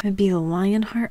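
soft, unvoiced read-out; hushed aside; whispered aside. Real, not acted. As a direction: murmur it under your breath. Reading a player's line.

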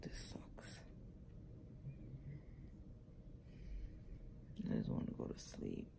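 hurting, whispering through a sore mouth; barely voiced after wisdom teeth; pained whisper. Real, not acted. Whispering in pain after surgery.

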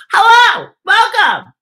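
high, loud happy greeting to the dog. Real, not acted. Host welcoming her dog into the room, delighted and high. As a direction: sing out hello to a pet.

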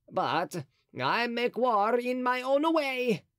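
the cardinal gloating over his bag of coins; voicing Mazarin. A narrator's reading. Narrator voices the cardinal Mazarin.

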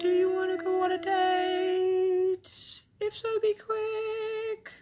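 very high, slow squeaky rhyme; high silly voice. Pretend. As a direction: stretch it out in a squeaky falsetto. Rhyming sung-style date pitch.

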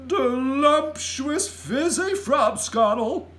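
loud, high, savoured slowly; drawn-out, delighted giant bellow; giant's shout. A narrator's reading. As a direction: shout it with huge relish. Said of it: "he shouted".

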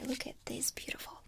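normal talking voice

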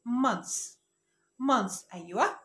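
months said as one syllable, mans, no extra ez; correct pronunciation. Shown as a demonstration. Teacher gives viewers the right months. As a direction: say months with no extra vowel at the end. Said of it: "النطق الصح بتاعها".

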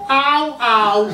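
a puppy's failed bark; yelped au. Acted. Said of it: "vykřiknu".

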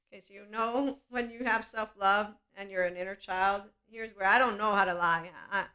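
ordinary talk, nothing marked

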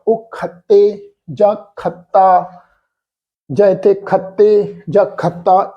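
ਖੱਤੇ and ਖੱਤਾ with a doubled ਤ, slowly; wrong pronunciation demo. Shown as a demonstration. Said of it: "ਇਸ ਢੰਗ ਦੇ ਨਾਲ ਉਚਾਰਨ ਨਹੀਂ ਹੋਣਾ". (ਗਲਤ ਉਚਾਰਨ: ਖੱਤੇ, ਖੱਤਾ)

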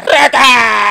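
alarido agudo a todo pulmón; grito de alarma. Retold or imitated. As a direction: grita la alerta, alargándola. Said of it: "La vaina que grita". (grito desgarrado imitando al tío espantado)

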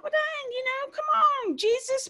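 high-pitched pleading whine; whiny high protest. Retold or imitated. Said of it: "I was like".

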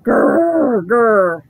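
loud playful growl. A narrator's reading. Kid clown growling at two girls, loud playful growl. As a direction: growl like a pretend monster. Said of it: "I made a scary clown face".

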